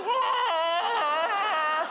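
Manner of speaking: long, high-pitched scream; hammed-up sustained shriek of fright; terrified scream. Screams as bear loses it.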